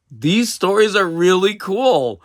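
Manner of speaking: a real thrill in the voice; excited gush. Real, not acted. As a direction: gush about how cool they are. Gushing about the ghost stories.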